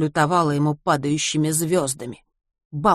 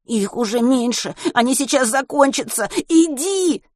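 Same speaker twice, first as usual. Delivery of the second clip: much higher, rising panic; begging; 8 semitones higher than usual. A narrator's reading. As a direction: beg him to go. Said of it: "умолял Веня".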